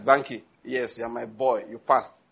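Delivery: approving God. Retold or imitated. Voices God's quiet approval.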